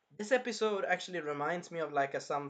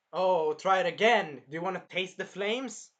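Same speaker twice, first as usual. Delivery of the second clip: taunting dare; 4 semitones higher, 6 dB louder than usual. Retold or imitated. Hero dares the dragon, loud taunting challenge. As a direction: start loud and dare them.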